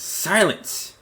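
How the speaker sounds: very high, loud furious Silence; commanding shout. Acted. Elven queen silencing her husband, furious shout. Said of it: "backhands him and says".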